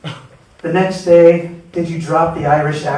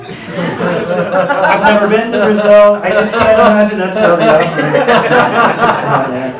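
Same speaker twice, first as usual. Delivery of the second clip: laughing; 5 semitones higher, 6 dB louder than usual. Real, not acted. Laughing through the Brazil story.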